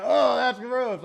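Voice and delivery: slow mock disgusted groan. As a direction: fake grossed-out reaction. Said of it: "I wouldn't be like".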